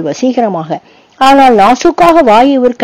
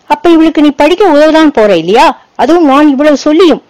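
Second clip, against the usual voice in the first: higher, louder, a hard threatening question; stern warning; 6 semitones higher, 3 dB louder than usual. A narrator's reading. Boy challenges his friend over helping her, stern warning. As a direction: make it a warning he won't repeat. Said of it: "கடுமையாக எச்சரிக்கும் குரலில்".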